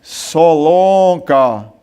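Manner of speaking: deep, slow, drawn-out captain's call; army captain's call. Retold or imitated. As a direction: stretch the name like a stern captain. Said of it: "o capitão disse assim". (capitão chamando o recruta com voz grave e arrastada)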